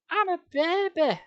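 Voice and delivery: high, slow self-pitying whine; mock baby whine; whiny wail. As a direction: wail about being a baby.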